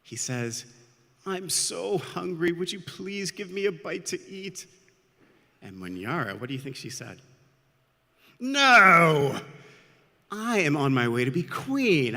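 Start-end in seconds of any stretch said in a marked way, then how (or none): 1.2-4.7 pleading. A narrator's reading. Hungry young boy begs a traveler for food, pleading. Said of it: "the boy pleads with her". 8.4-12.2 loud, high, imperious outburst; haughty refusal. A narrator's reading. Proud sister turns down a begging boy, haughty and loud.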